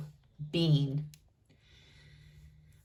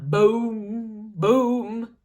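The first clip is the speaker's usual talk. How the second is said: louder boom boom; drum boom; 4 dB louder than usual. A narrator's reading. Bean banging his drum, louder. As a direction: thump like a drum.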